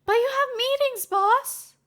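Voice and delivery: high, loud call after the boss; a high-voiced assistant calling out; high young woman calling to her boss. A narrator's reading. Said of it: "Vernon called after him".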